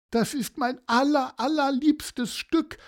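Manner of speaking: high, plaintive grandma voice; tearful old lady. Acted. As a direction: say it high, close to tears. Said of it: "sie musste wieder anfangen zu weinen". (hohe, weinerliche Omastimme)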